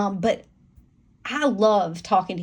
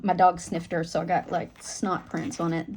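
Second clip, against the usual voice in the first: ordinary talk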